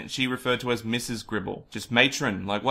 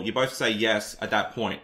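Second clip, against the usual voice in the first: ordinary talk, nothing marked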